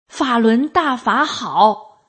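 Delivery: slow, projected shout within narration; shouted slogan. A narrator's reading. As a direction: call it out slowly, each word drawn out. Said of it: "他大声地喊叫道".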